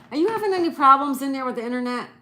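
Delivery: loud, fast call to the next room. Real, not acted. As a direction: shout through the house to be heard. Calling to someone in another room.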